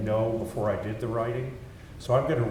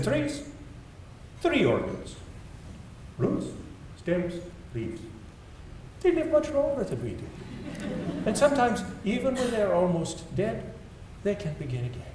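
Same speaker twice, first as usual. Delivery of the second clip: high-pitched mock French accent, unhurried list; Francis Allais, bad French accent; 10 semitones higher than usual. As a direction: bring back the playful French accent for the quote, much higher. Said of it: "you'll forgive my bad French accent".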